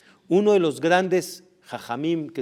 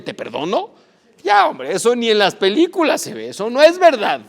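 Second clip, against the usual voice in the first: loud, higher, incredulous mockery; scoffing disbelief; 5 semitones higher, 9 dB louder than usual. The Polish president scoffing at the interpreter, incredulous. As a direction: scoff at a tale too good to be true.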